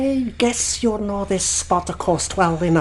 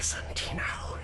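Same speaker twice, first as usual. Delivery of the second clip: soft, threatening whisper; sinister whisper; menacing whisper; 15 dB quieter than usual. Acted. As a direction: hush it with menace. Pew menacing the captain.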